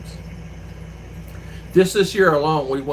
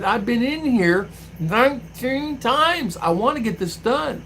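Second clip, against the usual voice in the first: exasperated complaint; 9 semitones higher, slower than usual. Retold or imitated. Retold outburst at the clerk.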